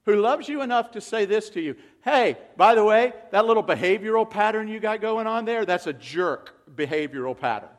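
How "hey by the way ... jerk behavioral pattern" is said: friendly rebuke. Acted. Voicing a loving rebuke.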